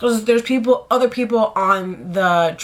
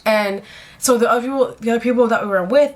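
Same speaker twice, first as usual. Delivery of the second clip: ordinary delivery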